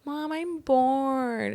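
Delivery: high, drawn-out mock whining; imitating a bored kid's complaint; bored child's whine. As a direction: whine to mom, stretching it out slowly. Child whining to mother in story.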